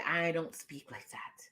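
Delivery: a prim, hushed whisper; whispered quote. Retold or imitated. Mimicking the prim writer's reply in a whisper. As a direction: breathe it out unvoiced and prim.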